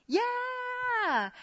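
high, drawn-out "yeah"; high-pitched excited reply; eager yes. Retold or imitated. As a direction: squeal out a long yes. Acting out her eager reply.

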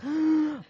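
sharp gasp. Retold or imitated. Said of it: "I'm just sitting here like".

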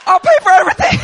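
high, loud terrified laughing plea; panicked squeal. Retold or imitated. Scared driver caving to the furious father, squealing in panic. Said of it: "I was so scared".

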